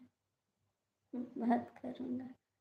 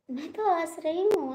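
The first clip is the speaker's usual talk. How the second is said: loud insistence; 4 semitones higher, 8 dB louder than usual. Real, not acted. Insisting loudly she's laughing.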